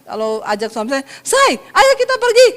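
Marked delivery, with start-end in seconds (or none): 1.2-2.5 high, louder call to a spouse; unrefined high tone. Shown as a demonstration. Wife inviting her husband out, pitched high and eager. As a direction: sing out the invitation high, before catching yourself. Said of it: "belajar juga mempraktekkan".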